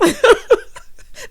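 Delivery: high, helpless real laugh; high-pitched burst of giggling; amused laughter. Real, not acted. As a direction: crack up mid-story. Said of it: "This was so funny to me".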